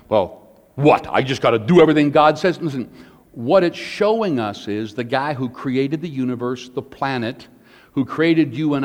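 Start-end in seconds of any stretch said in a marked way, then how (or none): 0.1-2.6 quick, louder mock-indignant protest; mock attitude. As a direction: imitate someone balking with a bit of attitude. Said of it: "gets a little attitude going".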